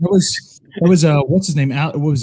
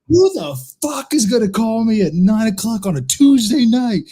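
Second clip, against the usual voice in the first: higher-pitched movie-dad outburst; mock outraged rant; 9 semitones higher, 3 dB louder than usual. Dad cursing a late phone call, mock outraged. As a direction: imitate a dad furious at the phone.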